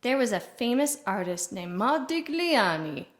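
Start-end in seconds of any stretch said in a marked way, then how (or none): 1.8-3.1 Modigliani with an Italian lilt; Italian accent. Shown as a demonstration. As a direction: put on an Italian accent for the name. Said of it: "how you would say it with an Italian accent".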